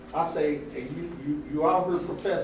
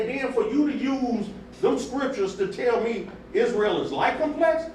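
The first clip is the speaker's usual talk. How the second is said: worked-up quote, pitched higher and louder; heated retelling; 5 semitones higher, 4 dB louder than usual. Retold or imitated. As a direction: replay the argument fired up, voice climbing. Retold heated argument with brother.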